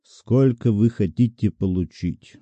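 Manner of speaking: ordinary delivery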